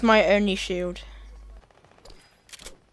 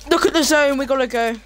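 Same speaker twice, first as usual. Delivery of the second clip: urgent call; 5 semitones higher, 7 dB louder than usual. Real, not acted. Urgent call during zone closing.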